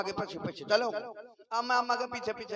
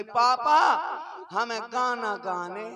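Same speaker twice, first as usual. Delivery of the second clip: loud teary cry of papa, then slow pleading; crying child; 2 semitones higher, 6 dB louder, slower than usual. Retold or imitated. Boy cries to his sleeping father, loud then pleading.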